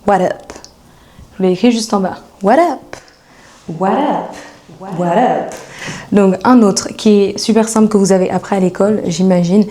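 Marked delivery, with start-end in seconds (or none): 0.1-0.5 WHAT UP with no S, run together; S dropped. Shown as a demonstration. Teacher showing the greeting without the S, S dropped. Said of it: "sans le S". 2.4-5.5 WHAT UP repeated as one linked word, no S; "what up" in casual American slang, S swallowed; S dropped. Shown as a demonstration. Teacher repeating the slang form for learners, S dropped. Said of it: "sans le S".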